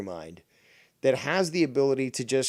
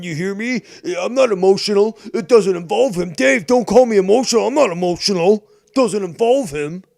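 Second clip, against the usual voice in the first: loud and higher, furious denial; shouting back at the man calling him emotional; angry shouting; 7 semitones higher, 11 dB louder than usual. Real, not acted. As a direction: yell that you're not emotional. Yells denial he's emotional.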